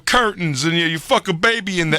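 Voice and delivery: high, slow crude Tracy voice; Tracy Morgan impression. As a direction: drawl out the filthy bits as Tracy. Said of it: "Tracy went from like the".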